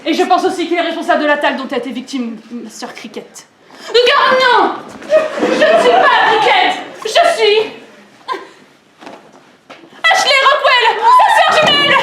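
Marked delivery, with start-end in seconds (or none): 3.9-12.0 loud, high theatrical reveal; dramatic shout. Acted. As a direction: shout the big twist to the room. Reveals she is the twin.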